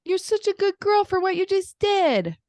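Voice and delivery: put-on high, loud little-girl voice; toddler praising baby. Three-year-old praising the baby sister, high and loud. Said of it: "she's like".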